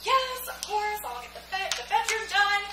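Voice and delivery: high, eager squeal of agreement; acted-out excited acceptance. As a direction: gush a delighted yes, pitch jumping up. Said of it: "And I was so excited".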